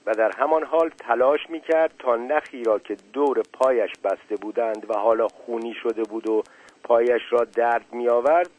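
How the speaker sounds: ordinary delivery, nothing marked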